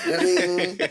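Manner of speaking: high sung ba-ding; high video game chime; power-up chime. Retold or imitated.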